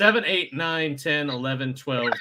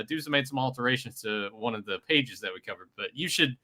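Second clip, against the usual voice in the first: ordinary talk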